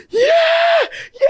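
high, shrieking cheer; a squealing replay of celebrating; excited cheering. Retold or imitated. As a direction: shriek it like your team just scored. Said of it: "cheering for my team".

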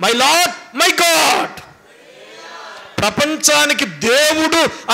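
fervent pulpit delivery; full-force preaching. Acted. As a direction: preach it out to the congregation. Preacher raising voice on Thomas.

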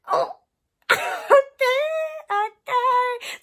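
high, loud hammed-up swooning; giddy mock delight. Girl retelling being walked to class, giddy mock squeal. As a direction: squeal it, playfully acting thrilled.

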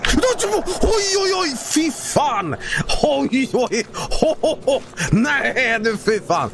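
high, panicky yelping in Swedish; panicked. Real, not acted. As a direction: yelp in alarm, then settle slightly. Startled in game, Swedish exclamations.

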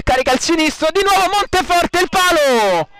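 rising shout at the post; excited call. Real, not acted. Shot hits the post.

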